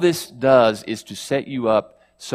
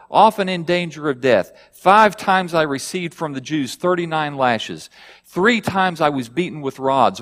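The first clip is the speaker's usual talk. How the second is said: higher, louder steady recitation of hardships; scripture reading; 4 semitones higher, 3 dB louder than usual. Acted. Preacher reading Paul's catalogue of hardships, raised reading voice. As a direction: list the sufferings with mounting weight. Long scripture list read aloud.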